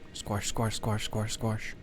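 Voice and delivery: soft, squishy squash, squash; quiet squelching squash sounds; squelchy squash sound. Shown as a demonstration. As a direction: murmur the squash, squash like wet squelching. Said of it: "That's the sound it makes".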